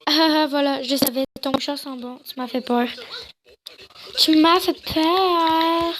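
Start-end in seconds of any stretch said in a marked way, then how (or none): 4.2-5.9 mock-dramatic, lingering vowels; slow drawn-out delivery. Player redoes a game line about a fright, slow and drawn-out. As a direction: stretch it out slowly, especially the last word.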